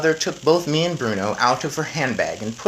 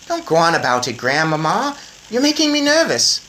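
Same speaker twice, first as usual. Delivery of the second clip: put-on lightly higher boy voice; boy mouse; 5 semitones higher, 7 dB louder than usual. A narrator's reading. Boy reminding grandmother, light eager boy voice. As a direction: point it out eagerly.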